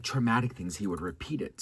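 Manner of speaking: ordinary talk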